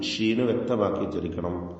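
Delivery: ordinary talk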